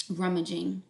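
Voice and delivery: usual speaking voice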